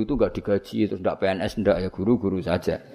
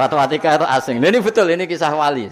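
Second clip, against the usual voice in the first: louder and higher, fired-up preaching; emphatic; 6 semitones higher, 7 dB louder, faster than usual. Real, not acted. Preacher tells the congregation this is a true saints' story, louder. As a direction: raise your voice to drive it home.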